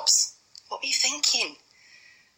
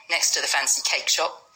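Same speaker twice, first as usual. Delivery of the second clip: ordinary delivery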